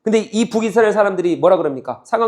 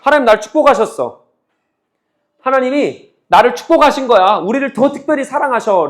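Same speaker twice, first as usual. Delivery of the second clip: lightly voiced smug self-assurance; a hint of complacent boasting; raised, self-satisfied claim of blessing; smug Israelites; 5 semitones higher, 5 dB louder than usual. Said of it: "지금 얘들은 착각하는 거죠".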